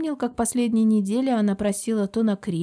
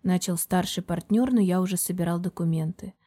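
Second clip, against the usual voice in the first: ordinary delivery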